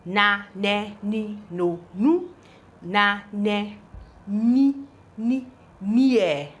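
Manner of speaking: "na, ne, ni, no, nu" chanted evenly, then "near"; syllable drill. Shown as a demonstration.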